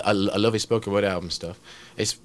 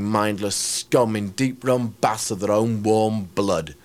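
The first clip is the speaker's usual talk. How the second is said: ordinary talk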